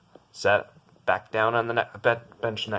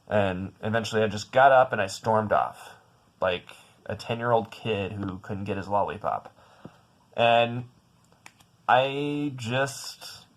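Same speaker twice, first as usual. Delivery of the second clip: ordinary talk, nothing marked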